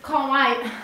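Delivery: ordinary talk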